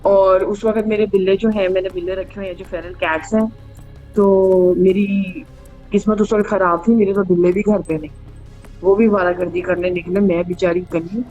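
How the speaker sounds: ordinary delivery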